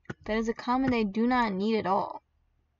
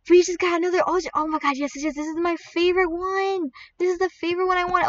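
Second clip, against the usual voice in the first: high-pitched excited rush; thrilled, breaking into happy yeses; overjoyed; 6 semitones higher than usual. Real, not acted. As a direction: blurt it out in delight. Gets the favourite pet.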